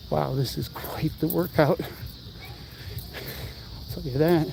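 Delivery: breathless from cycling; puffing while pedaling hard; out of breath. Real, not acted. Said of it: "My legs are burning".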